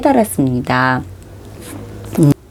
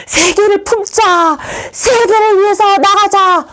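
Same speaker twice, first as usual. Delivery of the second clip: high, drawn-out mock-heroic slogan; rallying cry; 3 dB louder than usual. Student rallying friends to go out for the world, mock-zealous. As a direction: cry it out like a zealot rousing a crowd.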